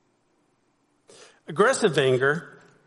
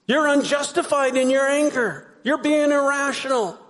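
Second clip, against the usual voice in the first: high, raised-voice reproach; heated, higher-pitched mock scolding; acted accusation; 9 semitones higher, 4 dB louder than usual. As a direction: voice the blame loudly. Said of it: "what do you hear?".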